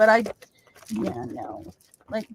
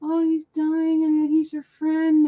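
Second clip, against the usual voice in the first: mock tearful plea; 5 semitones higher, slower than usual. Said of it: "And I'm like".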